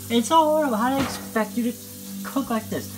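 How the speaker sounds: exasperated. Real, not acted. Guest complaining to viewers about the filthy kitchen, exasperated. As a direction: pitch it way up in disbelief.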